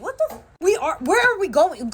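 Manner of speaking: high, incredulous protest; mock outrage at the principal; indignant retort. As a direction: act baffled and offended. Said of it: "I'm like".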